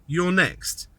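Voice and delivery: normal talking voice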